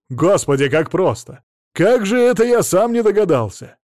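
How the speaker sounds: worked-up astonishment; amazed realisation. A narrator's reading. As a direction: marvel loudly at how obvious it was. Amazed at simple solution.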